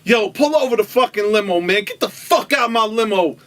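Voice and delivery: furious retold outburst; spazzing out. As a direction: act out a man losing it. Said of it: "Bill starts spazzing out".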